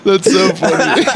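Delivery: slow breathless laughter; words through laughter; laughing. Real, not acted.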